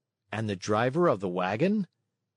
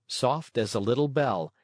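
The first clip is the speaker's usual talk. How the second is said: plain reading voice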